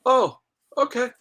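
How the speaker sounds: a surprised "oh" shooting up high; mock surprised relief. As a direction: act the surprised relief of finally arriving. Arriving after blizzard drive.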